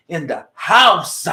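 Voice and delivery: slow, loud call with HOUSE stretched out; drawn-out catchphrase. Shown as a demonstration. Host leads listeners to join in, loud drawn-out catchphrase. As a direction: belt out HOUSE and hold it. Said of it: "You gotta say it with me".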